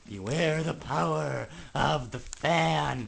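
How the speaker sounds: slow, hammed-up spooky warning; mock ominous. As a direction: intone it like a mock prophecy.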